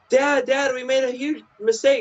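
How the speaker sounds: put-on high, frantic kid voice; squeaky panicked boy confessing; high cartoon kid in a panic; panicky kid. Kid voiced in retold scene.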